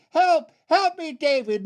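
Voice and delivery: very high, desperate cry; yelled cry for help. Acted. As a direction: scream for rescue from icy water. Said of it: "she surfaced and she yelled".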